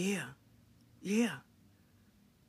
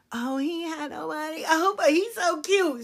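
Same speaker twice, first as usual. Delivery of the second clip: put-on much higher, swooning girly voice; mock lovesick girl; 9 semitones higher than usual. Mocking sisters excusing the broke guy, high and swooning. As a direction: gush over the cute guy mockingly.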